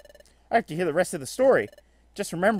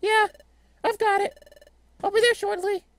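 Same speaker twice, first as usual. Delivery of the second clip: high character voice, wrong role. Acted. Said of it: "wrong character".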